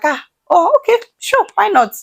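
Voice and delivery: high, bright-eager voicing of a helpful yes; mock eager agreement. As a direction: play the overly willing helper, high and sweet. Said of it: "good Samaritan that I am".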